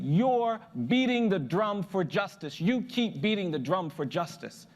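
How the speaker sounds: slow, ringing proclamation; emphatic old man. Retold or imitated. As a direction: proclaim it with weight, stretching the words. Old man's solemn verdict, acted.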